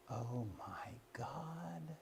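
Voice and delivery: slow breathless whisper; awed whisper. Retold or imitated. Woman looking up from the book, stunned, whispering slowly. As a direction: breathe it out slowly in sudden realization. Woman realizes her prayer mistake.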